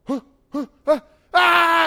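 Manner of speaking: high comic gasps breaking into a loud scream; hammed-up terrified yelp; panicked gasps, then scream. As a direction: gasp in mock panic, then shriek. Acts Elijah panicking and fleeing.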